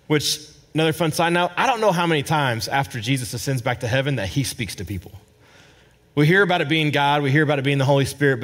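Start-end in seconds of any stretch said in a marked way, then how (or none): none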